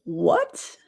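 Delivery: high, stunned what; shocked what. Real, not acted. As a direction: blurt it out in disbelief. Reacting to shocking message.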